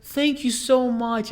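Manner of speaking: put-on slow, high old lady thanks; old lady customer. Old lady thanks the worker who helped, slow high voice. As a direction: draw out the gratitude as a sweet old lady.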